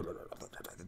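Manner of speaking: breathless whisper. Real, not acted. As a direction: drop to a whisper. Refusing the landlady's offer.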